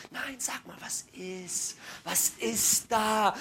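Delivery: hushed, drawn-out gentle prodding; coaxing concern. Acted. Husband keeps asking wife what is wrong, hushed coaxing. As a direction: whisper it, then stretch the last question.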